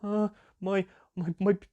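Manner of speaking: put-on high stuttering kid voice; mock-panicked kid stammering to the host; stammering scared kid. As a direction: stammer out the words like a spooked child. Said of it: "the kid would be like".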